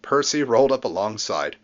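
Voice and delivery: usual speaking voice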